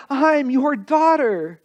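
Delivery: high, slow joyful call to her mother; a girl running in to tell her mother; calling out joyfully. Retold or imitated. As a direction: call it out in wonder. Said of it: "she called out in Korean".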